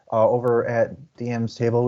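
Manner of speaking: normal talking voice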